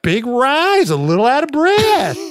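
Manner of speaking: squeaked way up high, Don Knotts style; high squeaky voice. Retold or imitated. Imitating how she said it to her partner, high squeak. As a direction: pitch it way up in a thin squeak. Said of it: "I said it like that".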